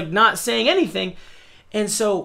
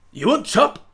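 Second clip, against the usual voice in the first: mock cook's broken English; 4 semitones higher than usual. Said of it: "in English, he points at the duck and goes".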